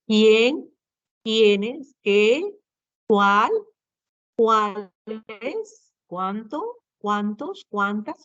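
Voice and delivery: each question word as its own rising question; entonación interrogativa. Shown as a demonstration. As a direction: lift the end of "Quién", "Cuáles", "Cuántas", one at a time. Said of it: "Son pronombres interrogativos". (palabra por palabra, en tono de pregunta)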